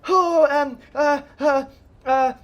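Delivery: high, loud panicked stammering; panicking. Acted. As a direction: babble in rising panic. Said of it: "starts panicking".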